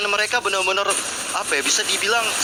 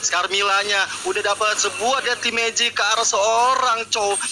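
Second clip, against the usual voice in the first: fighting game announcer; 5 semitones higher than usual. Acted. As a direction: ride the fight, rising with every kill. Hyped play-by-play of teamfight.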